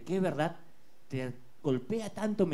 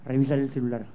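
inner voice command; 7 semitones lower, 5 dB louder than usual. Acted. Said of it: "Y la voz suena".

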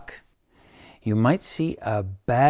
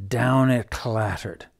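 plain reading voice

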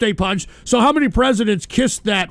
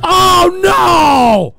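loud, high "oh no!" of alarm; mock horrified cry; 10 semitones higher, 9 dB louder than usual. Host hears enemy radio, shouts in mock alarm. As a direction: cry out as if ambushed.

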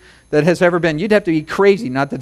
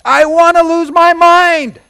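much higher, louder, slow pleading cry; fervent prayer; 9 semitones higher, 6 dB louder, slower than usual. Retold or imitated. Believer crying out to the Lord, loud and high. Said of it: "All you gotta do is say".

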